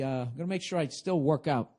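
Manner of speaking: ordinary talk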